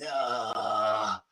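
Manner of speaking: low, soft mock groan of "no"; retold dismayed no. Parent recalling the kid getting up after bedtime, mock dismay. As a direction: draw it out like a weary, half-voiced protest. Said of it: "I was like".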